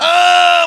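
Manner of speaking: loud, high drawn-out "ah"; mock fight yell. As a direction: yell out as you twist the hold. Acts out grabbing and twisting move.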